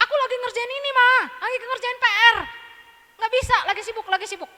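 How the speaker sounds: loud, high, fast whining refusal; a kid brushing off mom, annoyed; whiny child. Acted. Anak menolak disuruh ibu. (anak merengek keras dan tinggi, menolak disuruh)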